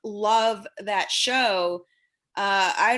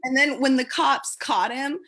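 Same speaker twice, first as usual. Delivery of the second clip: ordinary delivery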